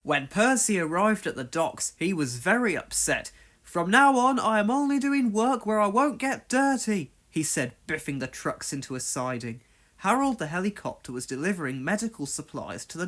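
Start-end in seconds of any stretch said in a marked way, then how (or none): 3.6-7.1 determined small engine. Acted. Percy speaking.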